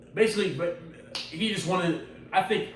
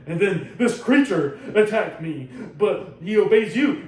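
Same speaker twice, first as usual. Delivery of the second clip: slow, loud, raised-pitch complaint; acted indignation; 6 semitones higher, 6 dB louder, slower than usual. Inquisitor reporting Vader's attack to the Emperor, acted indignant protest. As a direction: lean on each word in mock outrage.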